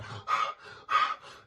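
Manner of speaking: imitated freak-out gasps; panicked gasping. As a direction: gasp like someone in a mock panic. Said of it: "he's just freaking out".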